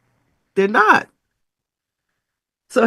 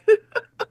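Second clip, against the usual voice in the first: high laugh. Real, not acted. Laughs at own congestion.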